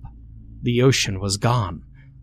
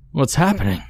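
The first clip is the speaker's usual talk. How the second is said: rising panic, high and strained; panicked cry; 6 semitones higher, faster than usual. A narrator's reading. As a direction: gasp it out in terror. Panic as the call takes hold.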